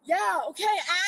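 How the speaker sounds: shouting. Real, not acted. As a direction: shout it back in defiance. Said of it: "Yelling and shouting".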